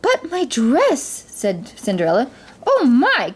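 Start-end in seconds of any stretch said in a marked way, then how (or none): 0.0-1.2 high, drawn-out protest; slow, high-pitched Cinderella voice, fretting; dismayed Cinderella. A narrator's reading. Cinderella worried about her dress. 2.6-3.3 high exclamation of surprise; fairy godmother crying out, pitched high; startled cry. A narrator's reading. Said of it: "cried the fairy godmother".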